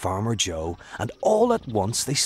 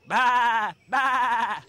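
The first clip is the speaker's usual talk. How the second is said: high bleating baa baa; sheep bleat; 3 dB louder than usual. A narrator's reading. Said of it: "said the sheep".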